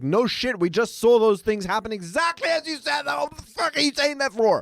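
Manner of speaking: high, incredulous ranting; mock exasperation. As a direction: rant at the screen in disbelief. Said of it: "And I'm like".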